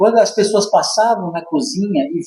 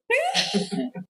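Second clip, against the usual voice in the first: risada aguda; 10 dB quieter than usual. Real, not acted.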